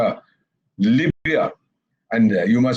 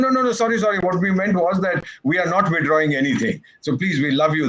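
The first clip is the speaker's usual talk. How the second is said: higher, hammed-up begging voice; mock pleading; 9 semitones higher than usual. Voicing countries begging France to keep selling, mock pleading. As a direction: grovel in mock apology, pitched up.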